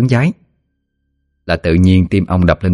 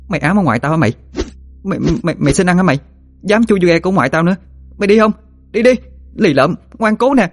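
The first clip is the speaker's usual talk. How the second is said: put-on high-pitched girl's tirade, rising as it goes; furious scolding; 11 semitones higher, faster than usual. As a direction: scold while lashing out at a ghost. Girl scolds and whips ghost. (giọng cô gái la mắng, cao vút)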